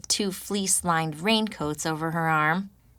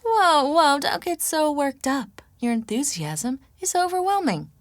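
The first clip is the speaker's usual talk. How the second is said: higher, wry teasing woman's voice; teasing Chris; 8 semitones higher than usual. A narrator's reading. Chris teasing the grumpy narrator, higher playful voice. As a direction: tease her, hands up, mock-calming.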